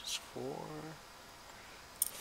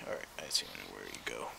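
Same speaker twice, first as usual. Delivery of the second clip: whispering; 14 semitones lower, 10 dB quieter, faster than usual. Real, not acted. Muttering to himself while testing parts.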